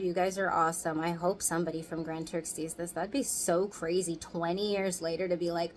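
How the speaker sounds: normal talking voice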